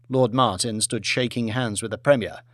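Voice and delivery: usual speaking voice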